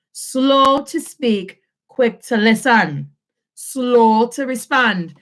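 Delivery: slow, deliberate teacher pace; slow emphatic delivery. Shown as a demonstration. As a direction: spell it out slowly for the class.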